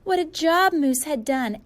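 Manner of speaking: ordinary delivery, nothing marked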